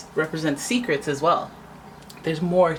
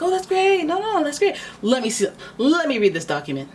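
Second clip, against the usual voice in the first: much higher, sugary fake delight; mock sweet politeness; 11 semitones higher than usual. Querent faking delight at a shady offer, sugary and high. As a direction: gush with fake cheer while you stall. Sweetly stalling a pushy seller.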